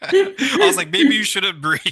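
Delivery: laughing through the words, pitch up; can't stop laughing while telling it; giggling punchline. Real, not acted. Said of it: "What was that?".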